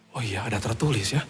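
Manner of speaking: soft, low murmur to oneself; quiet realisation. Retold or imitated. Patient recalling the verse he read, quiet realisation. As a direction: say it very softly, as if just remembering.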